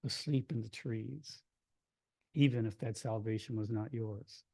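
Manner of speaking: low-key, measured poem reading with pauses; poetry reading. Acted. Poet reading to a hushed audience, quiet measured verse. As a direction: read the poem softly, phrase by phrase.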